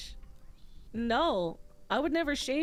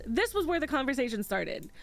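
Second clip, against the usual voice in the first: normal talking voice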